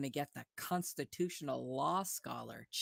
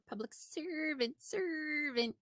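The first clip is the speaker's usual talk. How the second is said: high, slow, drawn-out animal call; frauditor mating call; 11 semitones higher than usual. Retold or imitated. Narrator mocks the frauditor's call as a mating cry. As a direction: bellow it like a bird's mating cry. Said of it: "the rare mating call".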